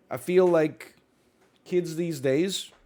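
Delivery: normal talking voice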